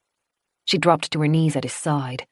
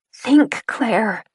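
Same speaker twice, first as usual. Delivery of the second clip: urging herself; 6 semitones higher, 4 dB louder than usual. A narrator's reading.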